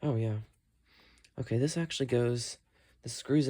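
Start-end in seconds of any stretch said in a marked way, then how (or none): none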